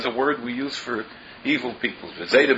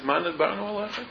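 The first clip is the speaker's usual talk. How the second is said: mock innocence; 8 semitones higher, 3 dB quieter than usual. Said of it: "in all innocence says".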